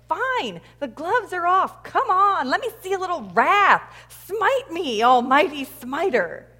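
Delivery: loud, high, furious challenge to God; acted fury. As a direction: dare God, acting disgusted and enraged. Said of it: "so now Bruce is furious".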